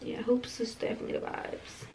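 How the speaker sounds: soft whisper to herself; real whisper. Real, not acted. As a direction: murmur it to yourself, hushed.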